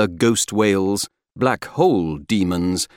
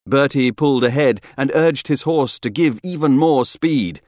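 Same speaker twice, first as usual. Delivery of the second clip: plain reading voice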